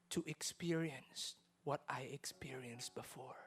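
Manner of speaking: low, soft, half-whispered reassurance; hushed voice of God. Retold or imitated. God comforting the weeping salesman, soft half-whisper. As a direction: breathe it gently, like comfort from above. God's words retold in prayer.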